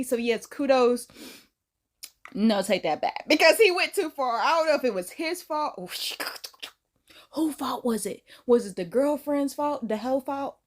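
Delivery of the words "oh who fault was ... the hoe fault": usual speaking voice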